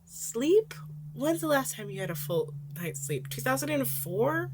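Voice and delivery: high, incredulous jab; mock the lecture; teen scoffing at his mentor's lecture; sarcastic scoff. Sarcastic retort in his head.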